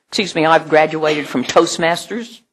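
mock self-assured applicant; a hint of a boastful candidate; cocky applicant. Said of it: "somebody comes up to me and says".

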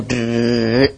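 low, dragged-out mock duh; mock someone as dim with a low duh; mock dumb duh. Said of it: "you immediately go".

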